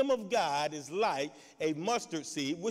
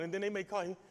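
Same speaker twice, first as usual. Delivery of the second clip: ordinary talk, nothing marked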